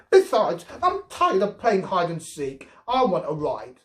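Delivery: put-on high, loud whiny child; sulky demand. A narrator's reading. Girl demanding a ride from Robbie, sulkily. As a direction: whine to get your way.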